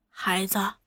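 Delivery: hushed, leaning close; low murmur. A narrator's reading. Old mother whispering to young woman, hushed. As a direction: murmur it in her ear. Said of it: "忽又附耳低声问".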